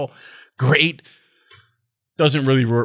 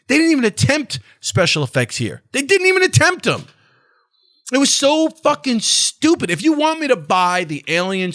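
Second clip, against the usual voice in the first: exasperated rant; 8 semitones higher, 4 dB louder than usual. Real, not acted. Ranting about cheap special effects.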